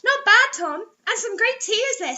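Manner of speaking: hint of a pleased director. Director voiced.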